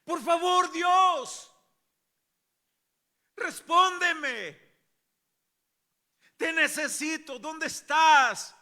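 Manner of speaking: very high, loud drawn-out cries; desperate clamor. Acted. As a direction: shout a desperate plea to heaven. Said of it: "con un clamor así". (clamor desesperado a gritos)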